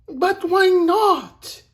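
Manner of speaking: high, loud, drawn-out protest; pleading girl. A narrator's reading. Mirette questions Bellini's refusal, high pleading protest. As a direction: wail the question, high and slow. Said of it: "asked Mirette".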